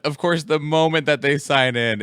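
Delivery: ordinary talk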